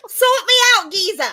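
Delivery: put-on high, laughing Cockney street girl; cheeky Cockney, laughing; Cockney accent. Shown as a demonstration. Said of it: "how one of those prostitutes would have sounded".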